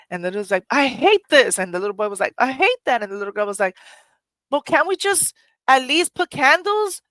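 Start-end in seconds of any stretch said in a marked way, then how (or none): 0.7-1.6 put-on higher, louder sulky child; child protesting to mother; upset kid. Child complaining about no electricity. 2.4-3.0 put-on higher, louder sulky boy; little boy protesting to mother; upset little boy. 4.5-7.0 put-on much higher, louder girl's plea; little girl pleading with mother.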